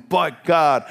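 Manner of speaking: "But God" loud and high, falling at the end; shouted refrain. Acted. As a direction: ring it out over the fears. Refrain shouted amid worries.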